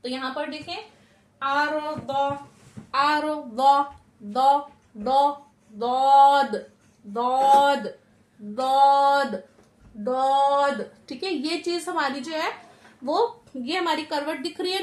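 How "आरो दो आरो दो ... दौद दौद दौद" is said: दौद said loud and heavy from the tongue's side; teacher drilling the Daad letter loudly; Daad demo. Shown as a demonstration. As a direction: stretch दौद slowly, full-mouthed and loud. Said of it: "जबान की करवट हमारे पांच दाढ़ों से लगानी है".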